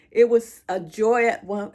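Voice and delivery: forcing the words out before breaking off; choking up. Real, not acted. Grieving mother trying to describe her joy, voice catching. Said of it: "gosh, if I can get it out".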